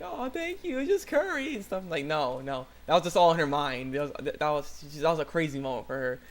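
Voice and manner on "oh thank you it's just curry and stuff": very high, coy mock-modest voice; gushing girl imitation. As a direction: play a flattered girl up high. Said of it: "she's like".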